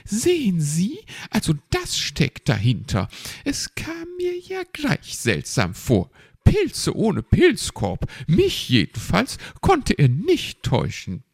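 high, know-it-all bird voice; self-satisfied, high-pitched gossip; Frau Elster. A narrator's reading. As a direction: play a chattering magpie, pitched far up. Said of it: "wie Frau Elster zu Herrn Fuchs sagte".

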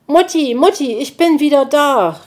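hoch und laut gerufen; rufend. Acted. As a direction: ruf freudig nach der Mutter. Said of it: "rief Borstel". (Borstel ruft seine Mutti laut)

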